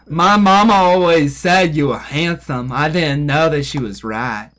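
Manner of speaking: slow Southern simpleton drawl; slow, mock-Gump Southern voice; Forrest Gump drawl. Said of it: "She'd be over here like".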